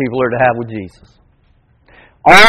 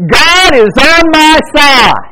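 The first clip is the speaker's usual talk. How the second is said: call for response; 12 semitones higher, 5 dB louder, slower than usual. Shown as a demonstration. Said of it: "Would you say that with me?".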